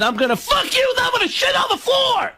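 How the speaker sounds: high, raw comic yell; Sam Kinison scream. Retold or imitated. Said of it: "I bent her over and I said".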